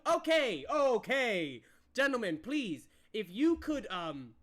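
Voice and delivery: loud, high interruption, easing off; exasperated but polite peacekeeper; calling for order. Acted. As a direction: cut through the bickering. Sir Toby breaks up the quarrel.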